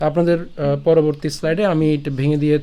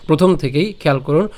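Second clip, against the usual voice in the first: ordinary delivery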